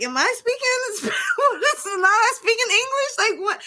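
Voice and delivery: high incredulous squeal through a laugh; disbelieving, half-laughing, high; retold disbelief, pitched high. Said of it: "it's like".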